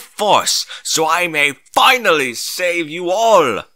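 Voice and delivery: high, loud, slow and grand heroic proclamation; rally the crowd like a noble savior; gallant hero voice. A narrator's reading. Hero pony rallies the ponies, grand and gallant. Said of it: "he said gallantly".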